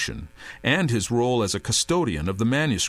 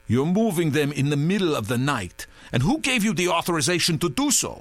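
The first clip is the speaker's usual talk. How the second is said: interrogating; 5 semitones higher than usual. A narrator's reading. Redbeard interrogating.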